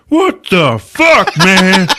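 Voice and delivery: high, loud, slow mock wail; hammed-up indignant monster; outraged beast. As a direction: yell it like a monster being burned. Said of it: "The beast is like".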